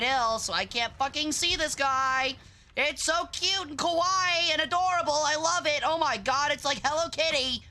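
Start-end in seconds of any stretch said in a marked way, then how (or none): 2.8-7.6 high, gushing sarcastic sweetness; mock cutesy fangirl gushing. Sarcastic cutesy gushing about bad spot.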